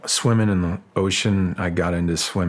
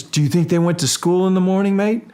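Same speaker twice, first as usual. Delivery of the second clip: mocking guide, much higher and louder; teasing dive guide; 11 semitones higher, 4 dB louder than usual. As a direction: poke fun at the question like the guide did. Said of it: "And he says".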